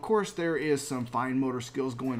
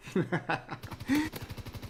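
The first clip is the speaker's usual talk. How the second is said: breathy laugh; 5 semitones higher, 4 dB quieter than usual. Real, not acted. Laughs at his own joke.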